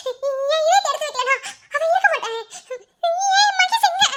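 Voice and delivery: high, loud, tearful begging; distraught pleading. Acted. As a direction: plead desperately through sobs for news of a loved one. Distraught, begs to see Mahesh.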